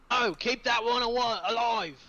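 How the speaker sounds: high, loud shouted order; in-character yell. Acted. Stinksack yells to allies to spare a prisoner, high shout. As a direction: yell it across the battlefield. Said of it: "Stinksack yells".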